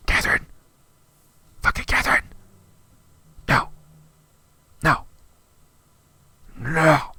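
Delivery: loud furious shout of the name; loud furious yell of Catherine; angry yell. Real, not acted. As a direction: yell it in disgust. Yelling the hated name.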